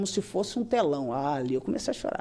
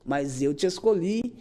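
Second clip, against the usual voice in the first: ordinary talk, nothing marked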